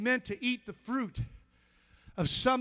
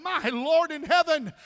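loud, higher, slowed panicked prayer; alarmed cry to God; 4 semitones higher, 7 dB louder, slower than usual. Retold or imitated. Preacher startled when the man falls, crying out. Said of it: "I went".

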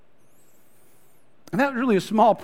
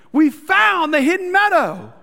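much higher and louder, slow and amazed; excited exclamation; 11 semitones higher, 7 dB louder than usual. Retold or imitated. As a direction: gasp it out like a thrilled discovery. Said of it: "He comes into the opening and goes".

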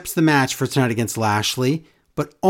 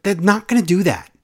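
ordinary delivery

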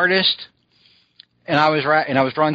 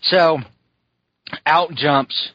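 ordinary talk

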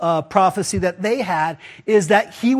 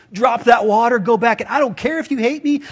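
higher, eager retold outburst; imitated excitement; 3 semitones higher than usual. Samaritan woman dropping her water to go tell, imitated excitement. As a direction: act out someone bursting to share news. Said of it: "how excited this lady must be".

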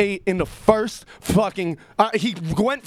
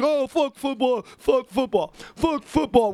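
mocking haters; 6 semitones higher than usual. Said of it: "everybody's like".

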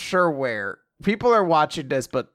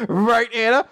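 loud, higher, hammed-up appeal; eager call-out; 6 semitones higher, 5 dB louder than usual. Lance appealing to his sister, loud and eager. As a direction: belt out the question for agreement.

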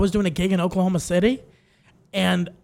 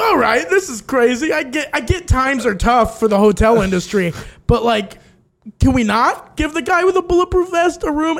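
higher-pitched, louder incredulous complaint; can't believe they allowed this; exasperated disbelief; 6 semitones higher, 5 dB louder than usual. Retold or imitated. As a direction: protest in high-pitched disbelief. Mock reaction to the front desk.

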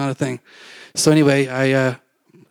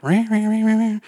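higher, mocking crowd grumble; mock grumbling rah rah; 8 semitones higher than usual. Teens grumbling at the stopped video, mock rah rah. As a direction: imitate teens grumbling in protest.

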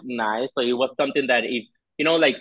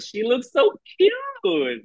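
high, drawn-out squeal of admiration; gushing; 12 semitones higher, much slower than usual. Retold or imitated. Co-host recalling her reaction to the glittery top, gushing. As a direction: coo it high and stretch the words out.

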